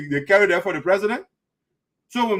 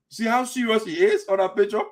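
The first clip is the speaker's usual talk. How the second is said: ordinary talk, nothing marked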